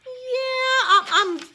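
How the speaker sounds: choked up, half laughing half crying; tearful laugh. Real, not acted. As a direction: voice catching through tears and laughter. Emotional start, crying and laughing.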